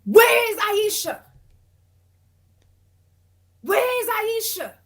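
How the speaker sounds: high, slow, loud call for Aisha. Retold or imitated. Woman calling upstairs for Aisha, slow and loud. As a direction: holler her name up the stairs, drawn out.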